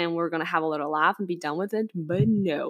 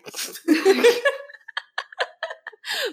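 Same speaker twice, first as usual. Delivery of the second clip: high burst of laughter. Real, not acted. Big laugh at snack joke.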